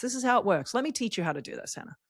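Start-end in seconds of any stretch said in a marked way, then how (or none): none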